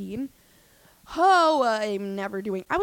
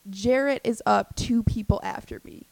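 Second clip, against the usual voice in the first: ordinary talk, nothing marked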